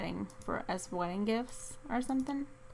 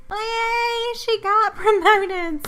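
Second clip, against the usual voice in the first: high squeaky cheer; excited squeal; 12 semitones higher, 10 dB louder, much slower than usual. Acted. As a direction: squeal it out with joy. Squeaky celebration of sim's promotion.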